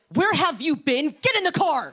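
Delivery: high, loud scolding yell; furious mom. Acted. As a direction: yell like a mom who found her lost kid. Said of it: "like a mom whose kid got lost".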